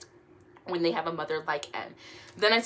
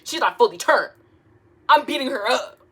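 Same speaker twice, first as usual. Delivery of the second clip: fired up; 6 semitones higher, 10 dB louder than usual. Real, not acted. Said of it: "fired-up reaction".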